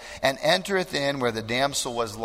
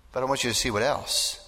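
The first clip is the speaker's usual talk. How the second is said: ordinary talk, nothing marked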